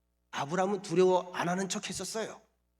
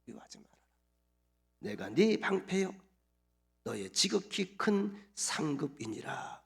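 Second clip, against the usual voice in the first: calm warm voice; 4 semitones lower than usual. Pretend. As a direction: speak it like a gentle father comforting a child. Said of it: "아주 차분한 목소리로, 따뜻한 목소리로".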